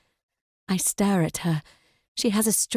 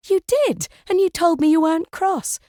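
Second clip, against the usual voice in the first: indignant, high insistence; 9 semitones higher, 4 dB louder than usual. Acted. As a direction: pitch jumps up, protesting. Amy insists, upset.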